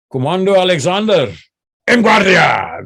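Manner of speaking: acted drill call, building to a shout; instructor's command. Commando instructor springing the trigger words on a trainee, acted shouted command.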